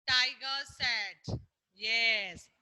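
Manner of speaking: ordinary delivery, nothing marked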